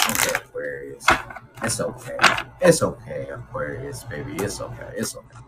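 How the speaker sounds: soft, hushed reassurance to a hurt friend; soothing comfort. Pretend. Reader soothing a rejected Aquarius, soft and hushed. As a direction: ease them down gently, almost a whisper.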